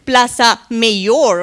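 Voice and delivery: slow, loud, anglicized "Plaza Mayor" said as PLASS-uh may-OR; English accent. Retold or imitated. Lecturer mimicking a tourist's heavy English accent, slow and loud. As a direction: drag out "Plaza Mayor" with flat English vowels like a lost tourist. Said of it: "English foreign accent".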